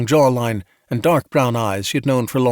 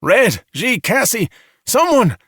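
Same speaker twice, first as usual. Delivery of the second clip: high, loud desperate calls; shouting; 11 semitones higher, 5 dB louder, slower than usual. A narrator's reading. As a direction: call out names, each one a cry for help. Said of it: "he shouted".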